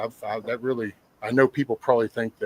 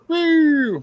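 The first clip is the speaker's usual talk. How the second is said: whoop; 7 dB louder than usual. Retold or imitated. Cop pulling him over.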